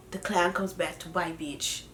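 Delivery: low, soft, menacing delivery; hushed punchline. Real, not acted. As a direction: drop it quietly like a threat. Punchline after a pause.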